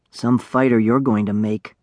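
ordinary delivery, nothing marked

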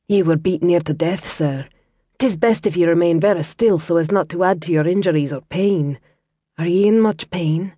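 tend him with a gentle Scots lilt; Scottish lass. Acted. Kira voiced with Scots accent.

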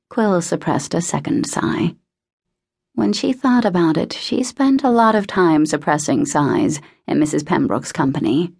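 ordinary delivery, nothing marked

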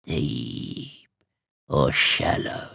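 low, soft, croaking crone voice; old witch croak. Acted. Old witch questioning the visitors, low croak. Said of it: "she croaked".

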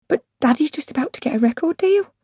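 put-on high, eager child's voice; protesting child. Daughter telling weary mum about record deal, protesting. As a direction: plead the big news to a parent. Child pleading with tired mother.

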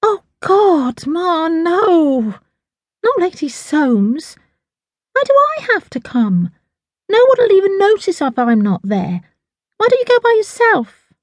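high, whining daughter; groaning protest. A narrator's reading. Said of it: "Tash groaned".

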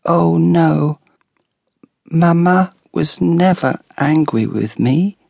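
put-on a small child's earnest protest; child defending her mother; little girl protesting. A narrator's reading.

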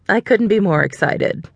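usual speaking voice

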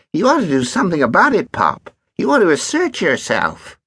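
put-on lazy teasing kid; cheeky boy voice. Ogden lectures Pett.

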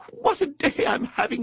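very high, delighted voice; blissful Mole. A narrator's reading. Mole leans back on the cushion, blissful. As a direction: squeak with contentment.

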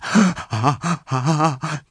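big, rising gasps before a sneeze; ah... ah... working up to a sneeze; sneeze build-up. Acted. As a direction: suck in air, about to sneeze.

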